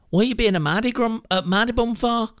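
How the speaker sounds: high-pitched mock northern English accent; imitated northern accent. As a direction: mimic a girlfriend's suddenly acquired northern accent. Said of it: "all of a sudden you've got this accent".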